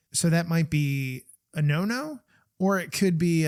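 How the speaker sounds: usual speaking voice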